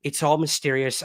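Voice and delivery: ordinary talk, nothing marked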